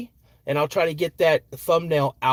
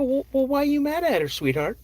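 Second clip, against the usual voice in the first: gentle dad; 11 semitones higher than usual. Retold or imitated. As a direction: soothe a hurt child. Dad soothing daughter.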